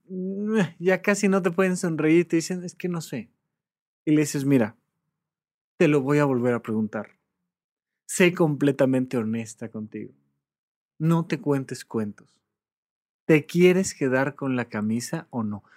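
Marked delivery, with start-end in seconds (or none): 2.6-3.3 quiet unsure mumble. Retold or imitated. Client voiced, blocked. 4.5-15.6 calm asking. Retold or imitated. Therapist asks calmly, lower.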